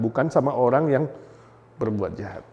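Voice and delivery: normal talking voice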